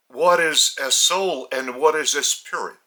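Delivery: ordinary talk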